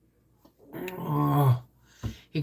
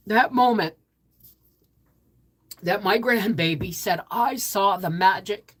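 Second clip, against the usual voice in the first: moved; 4 semitones higher, 3 dB louder than usual. Real, not acted. Emotional repeat.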